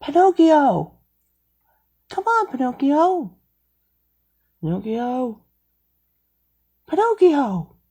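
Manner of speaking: high calls dying down to a whisper; calling, fading to whisper. Acted. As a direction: call out, then fade to nothing.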